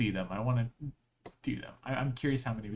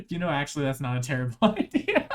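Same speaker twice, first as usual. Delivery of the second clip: ordinary talk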